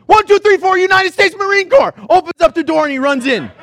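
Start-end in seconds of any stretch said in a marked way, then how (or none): 0.1-1.9 loud, higher drill shout of a Marine; shouted Marine battle cry; Marine count-off. Retold or imitated. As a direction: bark it like a Marine charging in. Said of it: "he goes".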